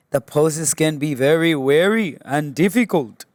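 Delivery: lilting mock Indian accent, pitch bobbing; bad Indian accent. Yoga teacher on how hard held poses get, bad Indian accent. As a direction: put on a clumsy Indian accent. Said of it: "that's a bad Indian accent".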